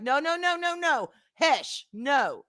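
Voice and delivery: mock shutting someone up; retold shushing. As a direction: imitate husband cutting her off. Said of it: "he shut me up".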